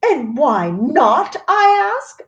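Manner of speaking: shouting mother, pitch leaping up on the last words; shouted. A narrator's reading. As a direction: fling the question out at full voice. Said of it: "shouted Missus Gloop".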